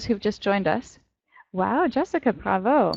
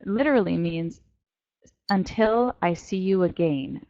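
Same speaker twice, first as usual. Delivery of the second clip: usual speaking voice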